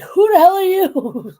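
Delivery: high, loud mock-indignant cat voice; startled outrage. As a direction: blurt it out as an affronted cat. Retelling startled cat's reaction to newcomer.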